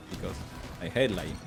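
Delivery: normal talking voice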